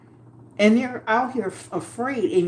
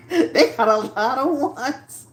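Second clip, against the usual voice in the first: said through a laugh; chuckling at the chat's answers; slow, amused laugh-talk; 4 semitones higher, slower than usual. Real, not acted. Chat voting, she laughs.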